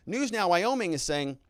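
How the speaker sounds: ordinary talk, nothing marked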